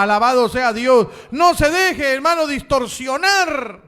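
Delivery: shouting preacher building to a peak; fiery preaching. Acted. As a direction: preach it out at full force. Preaching at full force.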